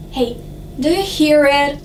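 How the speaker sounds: ordinary delivery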